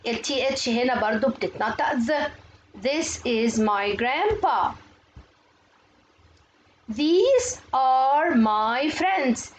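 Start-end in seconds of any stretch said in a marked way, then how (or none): 2.8-4.7 very slow, separated English words; slow model reading. Shown as a demonstration. Teacher models a textbook sentence for pupils, slow modelling. 6.9-9.5 very slow, stretched-out English words; slow model reading. Shown as a demonstration. Teacher models a textbook sentence for pupils, very slow modelling.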